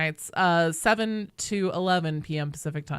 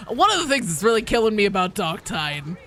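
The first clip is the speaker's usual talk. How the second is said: ordinary delivery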